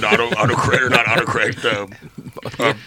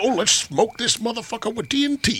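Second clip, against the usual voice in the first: gung-ho dynamiter; 13 semitones higher than usual. Acted. Joking about blasting the mine open.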